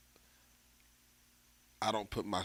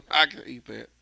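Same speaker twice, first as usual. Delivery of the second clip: laughing acceptance; 4 semitones higher, 9 dB louder, faster than usual. Retold or imitated. Laughs while accepting the criticism.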